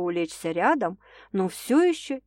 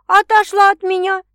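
high, louder playful yell; mock shriek; 12 semitones higher, 11 dB louder than usual. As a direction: act out the scream he should make. Quoted shout inside speech.

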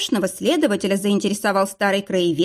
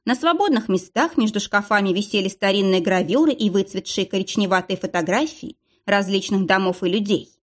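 ordinary delivery, nothing marked

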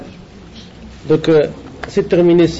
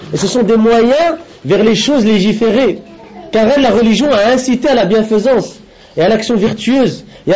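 higher-pitched, measured read-aloud in French; deliberate lesson-reading voice, raised in pitch; formal translation reading; 5 semitones higher than usual. Acted. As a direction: read out a translated religious text, phrase by phrase. Reads out French translation of text.

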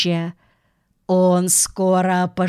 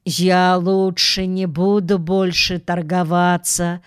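usual speaking voice

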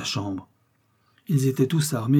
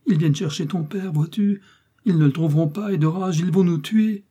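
high, anguished outpouring; desperate; 7 semitones higher, 4 dB louder than usual. A narrator's reading. Mother warning her son of killers, desperate cry. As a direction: plead in rising alarm, pitched well up. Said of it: "s'écrie-t-elle avec désespoir".

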